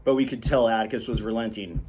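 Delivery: plain reading voice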